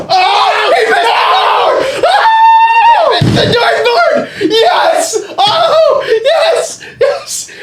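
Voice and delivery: shrieking with laughter, very high and loud; laughing shout. Real, not acted. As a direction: cheer and cackle at the miss. Opponent missed the dartboard.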